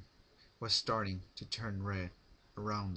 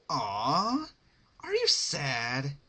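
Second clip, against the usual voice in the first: high, slow mock-sad coo; mock pity; 6 semitones higher, 7 dB louder, much slower than usual. A narrator's reading. Pinkie taunting captive Rarity with mock pity, high coo. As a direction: coo at her like a pouting child.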